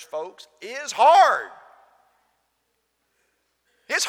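loud, high preaching push; fiery preaching. Acted. Preacher urging his congregation, loud and fervent. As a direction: drive it home with full force.

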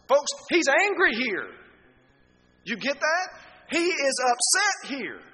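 high, loud, emphatic anger; angry. Acted. Preacher shows congregation Paul's upset tone, angry. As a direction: raise the voice, hammer it out slowly.

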